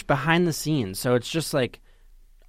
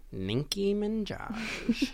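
slow, drawn-out name reveal; dramatic reveal; 8 semitones higher, 6 dB quieter, much slower than usual. Acted. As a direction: unveil the name slowly.

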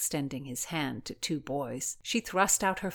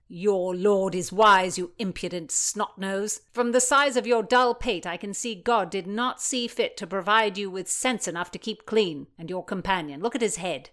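loud contemptuous tirade; a loud bullying nobleman; scornful bully; 3 semitones higher, 5 dB louder than usual. Acted. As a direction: sneer and pile on the insults. Blackjack insulting the pages.

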